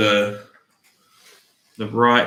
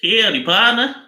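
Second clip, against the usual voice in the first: high, loud mock Southern twang; Southern accent; 10 semitones higher, 6 dB louder than usual. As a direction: belt out a badly faked Southern greeting. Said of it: "can you do a southern accent".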